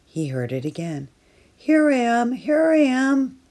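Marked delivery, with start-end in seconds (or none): 1.6-3.4 shouted. Acted. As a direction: yell to be found. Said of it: "Franklin shouted over and over".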